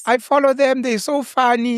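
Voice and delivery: slow, high mock Afrikaans lady; Afrikaans accent. Host mocking the lady's way of speaking, Afrikaans accent. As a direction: drag out the Afrikaans lady's gush. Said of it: "that's how the Indian lady was speaking".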